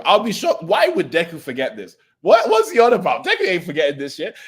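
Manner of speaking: high, loud disbelief; mock outrage at the idea; incredulous. Real, not acted. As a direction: react like it's absurd. Incredulous rant.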